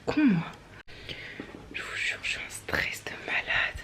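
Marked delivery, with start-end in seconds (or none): 1.7-3.8 breathless nervous whisper; hushed unvoiced whisper, almost to herself. Real, not acted. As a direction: whisper it, stunned and stressed. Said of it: "Je sais pas pourquoi je chuchote".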